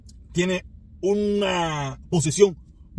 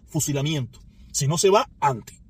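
normal talking voice

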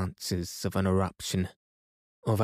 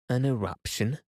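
shocked question, pitched up; gasped; 4 semitones higher than usual. A narrator's reading. Nephew echoing his uncle's news, gasped. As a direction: echo it in disbelief, rising high at the end. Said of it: "I gasped".

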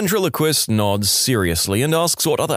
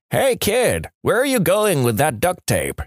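mock yelling; 7 semitones higher than usual. Old man on porch calls to kid, mock yell. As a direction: call to a passing kid in a raised pitch. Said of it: "Old guy yells out".

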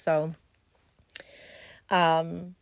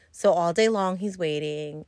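ordinary delivery

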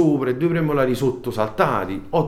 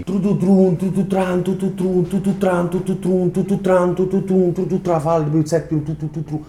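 mouth noise; 8 semitones higher than usual. Shown as a demonstration. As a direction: rattle off the blows like a drum roll. Imitating rapid punches with mouth.

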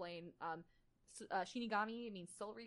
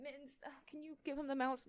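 talking to a cat; 7 semitones higher, 4 dB louder than usual. Real, not acted.

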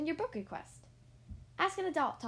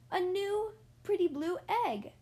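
higher, louder delighted lift; delighted reveal; 8 semitones higher, 4 dB louder than usual. Acted. Reader revealing the new egg to children, delighted and higher. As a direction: reveal it like a happy surprise.

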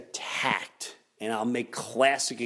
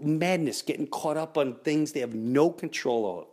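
usual speaking voice

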